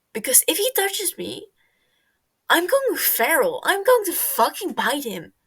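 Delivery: loud, high, building angry threats; furious rant. Acted. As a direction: build to a wild furious yell. Tommy's furious, rising threat rant.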